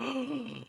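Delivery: sudden surprised gasp; sharp gasp. Real, not acted. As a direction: catch the breath in surprise. Reacts to a voice starting.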